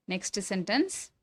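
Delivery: ordinary delivery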